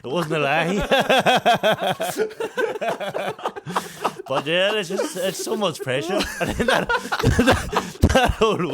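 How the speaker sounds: high, loud laughter through the words; cracking up at his own story; breaking into laughter; laughing. Real, not acted.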